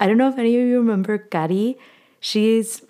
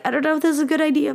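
imitating worried family; 7 semitones higher, much faster than usual. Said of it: "Even if they're like".

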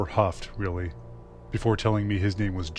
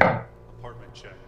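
eerie whisper; 3 semitones higher, 3 dB quieter, slower than usual. Retold or imitated. Stranger at door after dark.

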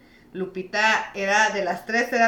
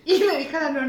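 ordinary delivery

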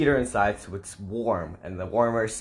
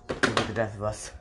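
ordinary talk, nothing marked

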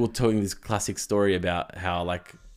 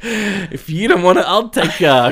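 loud, higher, drawn-out swagger; hammed-up cheeky offer; cocky flirt; 6 semitones higher, 8 dB louder, slower than usual.

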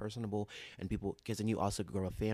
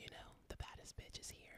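sultry voice; 18 dB quieter than usual. Shown as a demonstration. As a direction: whisper it like a seductress. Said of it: "let me stop talking that voice".

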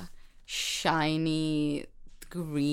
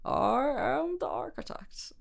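mock Scottish accent, drawn out slowly; 7 semitones higher, slower than usual. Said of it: "how well I can do a Scottish accent".

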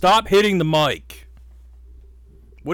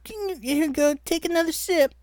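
high, eerie coaxing voice; creepy voice; 14 semitones higher than usual. Acted. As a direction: coax him creepily, way up high. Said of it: "that fucking creepy ass voice".

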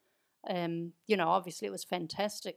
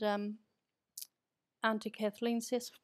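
usual speaking voice

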